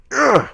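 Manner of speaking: high, loud mock grunt of effort; hammed-up grunt of frustration; angry grunt. Billy spikes the football, imitated angry grunt. Retold angry spiking of the ball.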